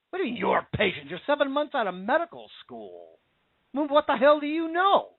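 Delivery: what the hell do you know, rising sharply high at the end; indignant retort. Retold or imitated. Parent scoffing at seven-months-out doctor, high indignant retort. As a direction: scoff at the young doctor, ending high and incredulous.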